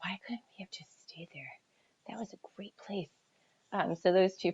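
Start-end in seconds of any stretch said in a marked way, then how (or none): 0.0-3.1 put-on soft breathy whisper of an old longing; whispered wish. Missing a childhood home, said in a whisper. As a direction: whisper it to yourself, softly.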